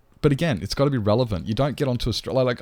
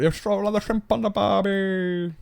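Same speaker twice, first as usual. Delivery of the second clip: cartoon Aussie accent, pitched way up; hammed-up Australian accent; 12 semitones higher than usual. Stereotype Aussie phrase hammed up.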